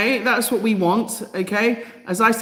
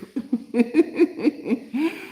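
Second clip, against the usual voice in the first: a real, high giggle at her own slip; giggling laugh; 8 semitones higher than usual. Real, not acted. Vlogger giggles at calling the Pope Lego. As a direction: break into a giggle.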